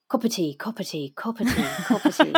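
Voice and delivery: Irish warm-up phrase, repeated; Irish accent. Shown as a demonstration. Narrator priming her Irish accent before a line, Irish accent. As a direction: slip into Irish on a catchphrase. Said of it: "before the Irish, I'd, I'd just have to go".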